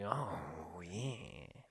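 low, very soft murmur; soft, low appreciative reaction; hushed awed approval. Retold or imitated. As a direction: let it out quietly, impressed. Acts out turned-on reaction to scene.

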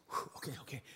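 breathy, unvoiced little gasps of alarm; a soft, half-acted fluster before calming down; mock panicked breath. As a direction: catch your breath as if just startled. Acting out a jolt of panic.

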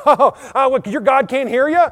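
high, loud mocking jeer; loud, theatrical ridicule; taunting. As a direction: jeer at them with a laughing edge. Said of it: "He started taunting them".

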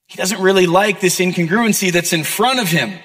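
ordinary delivery

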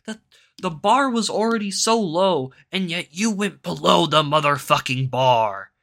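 high, loud disbelieving outburst; exasperated rant. Retold or imitated. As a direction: shout it in outraged disbelief. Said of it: "And it's like".

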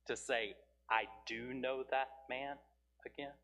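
reflective preaching. Acted. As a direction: linger over each phrase, inviting thought. Slow reflective preaching questions.

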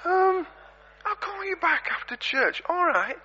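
high, chatty lady voice; lady on the phone. Retold or imitated. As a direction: pitch it up like a flustered churchgoer on her phone. Lady answers phone in church.

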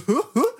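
short high, loud mocking laugh; imitated friends' laughter. Imitating friends laughing at his flop, high burst of laughter. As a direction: burst out laughing like teasing buddies. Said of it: "These dudes are like".

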